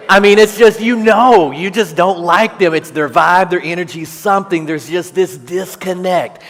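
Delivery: emphatic outburst. Real, not acted. Pastor admits to the congregation he dislikes strangers, loud and amused. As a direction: blurt it out, amused at your own pettiness.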